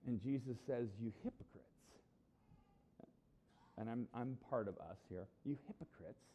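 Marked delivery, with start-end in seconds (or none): 1.0-1.7 softer, lower, subdued reading; rebuke turned inward. Acted. Jesus talking to us as the Pharisees, quiet rebuke. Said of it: "now I want to read it". 5.4-6.2 soft, subdued reproach; rebuke turned inward. Acted. Jesus to us as the audience, quiet reproach. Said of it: "we're the audience that Jesus".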